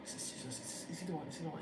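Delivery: whispering to themselves. Retold or imitated. Disciples wondering among themselves, whispered. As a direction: whisper it as if nobody should hear. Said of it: "talking to themselves".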